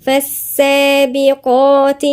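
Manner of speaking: فالسابقات with a doubled sin and heavy qaf; drawn out فالسابقات; tajweed breakdown; slow demo. Shown as a demonstration. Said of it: "نقولها إزاي؟".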